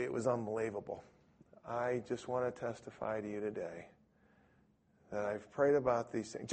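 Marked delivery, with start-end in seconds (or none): 1.6-6.5 imitating a faint, limp delivery; softly, with no conviction; weak testimony. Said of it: "he said it so weakly".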